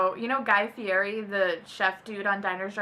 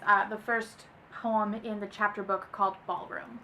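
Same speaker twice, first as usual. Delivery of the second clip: usual speaking voice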